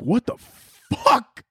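high, drawn-out mock shock; stunned disbelief. Viewer blindsided by a dark scene, stunned disbelief. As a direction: blurt it in high, stretched bewilderment.

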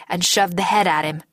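ordinary delivery

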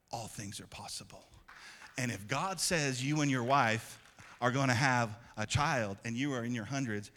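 usual speaking voice

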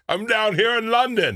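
high, slow fake London accent; bad British accent. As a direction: overdo a tourist's London British. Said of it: "with a British accent".

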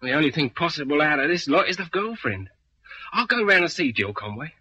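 ordinary delivery

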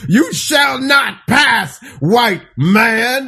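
high, slow booming shout; angry warrior voice. Pretend. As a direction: roar it like a guardian blocking the way.

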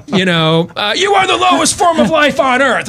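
high shouted drill sergeant insult; barking gym coach. Retold or imitated. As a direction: bark it like a drill instructor. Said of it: "the New Year's pep talk".

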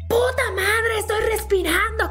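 high mock yell imitating an exasperated mother in labour; high-pitched mock outburst of a cursing mother; mom's retold cursing. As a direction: play the furious mother swearing at the doctors, hammed up. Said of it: "se la pasó mentando madres".

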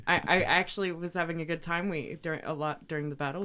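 ordinary talk, nothing marked

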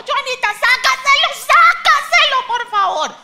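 high, loud desperate plea; pleading shout. Retold or imitated. Mother begging the dentist, high desperate shout. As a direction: beg the dentist at the top of your voice. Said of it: "Y yo le decía a".

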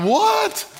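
very high, squeaked question; hammed-up incredulous yelp, shooting up in pitch; shocked disbelief. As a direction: act a kid's stunned outburst. Child shocked by grandfather's claim.

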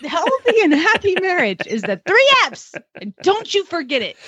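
put-on a knowing old lady's voice; imitating an old lady. Retelling grandma's marriage rule, mock stern. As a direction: wag a finger like a grandma giving rules. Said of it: "She goes".

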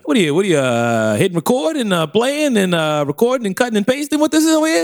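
high, nosy New York patter; New York construction worker. Pretend. Worker eyeing the recording gear, nosy New Yorker. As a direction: pile up questions like a worker butting in. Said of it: "turned into a New York construction worker".

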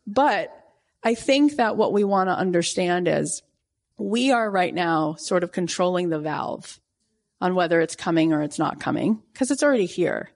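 ordinary talk, nothing marked

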